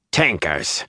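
scornful one-word exclamation; contemptuous jibe. A narrator's reading. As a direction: say it as an insult. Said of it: "Hari's mouth twisted scornfully".

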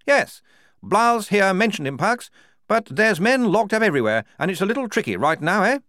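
high, loud, fast officer, brushing it off; brisk major. A narrator's reading. The major dismissing Polly's question, brisk and loud. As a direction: rattle past the question. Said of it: "said the major briskly".

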